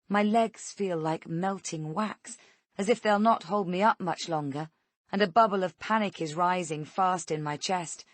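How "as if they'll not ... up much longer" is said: ordinary delivery, nothing marked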